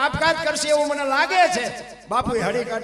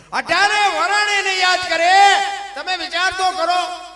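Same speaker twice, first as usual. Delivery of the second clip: loud, high, slowed preaching call; preaching cry; 9 semitones higher, 9 dB louder than usual. Real, not acted. Preacher calling out to the gathering, loud and high. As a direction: lift the crowd with a ringing cry.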